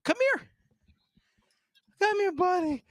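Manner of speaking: high coaxing call to the dog; high coaxing dog-talk; pet talk. Real, not acted. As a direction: coax the dog over, sing-song. Calling the dog.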